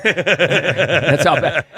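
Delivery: laughing through the words; chuckling while talking. Real, not acted.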